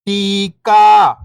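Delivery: whole word, level then falling at the end; syllables blended. Shown as a demonstration. Teacher showing beginners the joined word, syllables blended. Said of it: "इनको आपस में लाके पढ़ना है".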